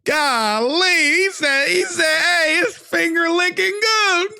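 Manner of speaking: high-pitched laughing retell; laughing through the quote. Real, not acted. Commentator cracking up retelling.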